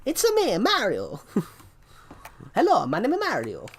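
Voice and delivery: high, loud Italian cartoon accent; cheerful Italian-accented Mario greeting. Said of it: "my Mario impression sounded pretty good".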